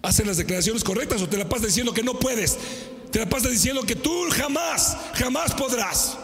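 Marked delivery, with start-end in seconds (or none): 3.1-6.2 emphatic preaching shout. Real, not acted. Preacher rebuking a crowd who says they can't. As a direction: drive home jamás podrás.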